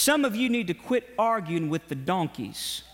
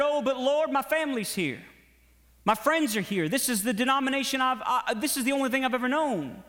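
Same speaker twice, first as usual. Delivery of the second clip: high, fast, whiny excuse-making to God; a churchgoer begging the Lord to let them stay; pleading protest; 7 semitones higher than usual. Pretend. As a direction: plead your case like a clingy churchgoer.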